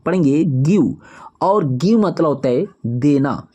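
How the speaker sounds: ordinary talk, nothing marked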